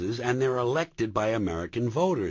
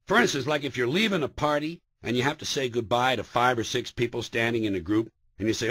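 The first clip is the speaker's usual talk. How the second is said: ordinary talk, nothing marked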